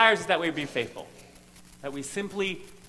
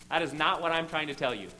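ordinary delivery

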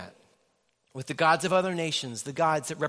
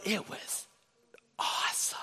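softer, breathy hush of awe; awed hush; 7 dB quieter than usual. Real, not acted. Preacher recalling a Porsche, hushed in awe. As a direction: hush the word like you're stunned.

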